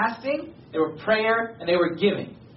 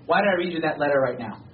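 normal talking voice

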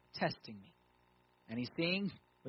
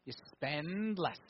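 slow, drawn-out "spend less"; spelled out; much slower than usual. Shown as a demonstration. Preacher spelling out a supposedly foreign concept, slow and deliberate. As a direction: stretch the words out as if spelling out the obvious. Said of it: "I'm gonna spell it out".

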